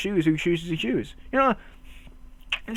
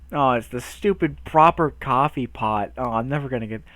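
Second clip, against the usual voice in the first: usual speaking voice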